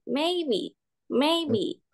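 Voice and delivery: MAYBE drawn out on a high pitch; first tone. Shown as a demonstration. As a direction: hold the MAY of maybe high. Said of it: "nada satu berarti kita pakai". (maybe dengan nada satu yang tinggi)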